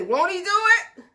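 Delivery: high, loud catchphrase call; imitating Paul Martin. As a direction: shout it like a gospel radio host. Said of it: "Oh, Steve Harvey. Paul Martin".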